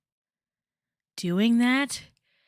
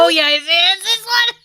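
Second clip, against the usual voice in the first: excited; 10 semitones higher, 7 dB louder than usual. Real, not acted. Finds the clip excitedly.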